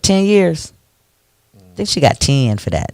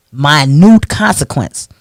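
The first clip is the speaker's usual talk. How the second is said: ordinary delivery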